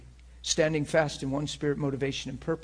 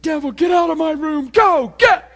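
much higher and louder hammed-up yell at the devil; mock shout; 14 semitones higher, 13 dB louder than usual. Preacher imitating a panicked yell at the devil, mock shout. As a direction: chase it out, shouting. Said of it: "I didn't even like".

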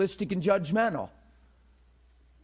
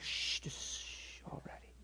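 whisper; 15 dB quieter, slower than usual. Retold or imitated. Hushing the objector.

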